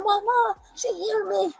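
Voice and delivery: high-pitched imitation of her son calling; imitating a little boy crying out for mom; child calling out. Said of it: "He said".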